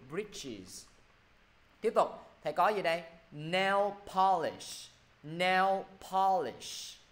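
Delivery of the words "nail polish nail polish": very slow "Nail polish" with a full sh; final sh sound. Shown as a demonstration. Teacher modelling new vocabulary, slow with clear final sh. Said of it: "Âm cuối cùng của nó là âm sh".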